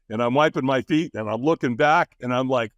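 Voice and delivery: usual speaking voice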